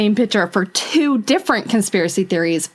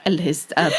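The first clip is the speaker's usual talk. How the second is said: creepy computer voice; 4 semitones lower than usual. Pretend. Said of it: "you sound like some kind of".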